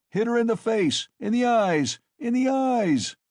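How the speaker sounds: high, loud urging shouts; crying out. A narrator's reading. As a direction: egg the crowd on at full pitch. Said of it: "cried Mikoka".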